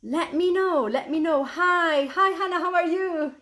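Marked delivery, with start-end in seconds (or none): none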